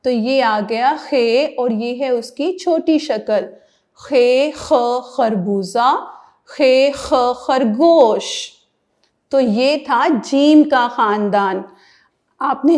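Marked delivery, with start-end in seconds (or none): 3.9-8.5 put-on خے خ said slowly and clearly for children; slow letter drill. Shown as a demonstration. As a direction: spell it out slowly, letter sound first. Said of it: "letter drill for children".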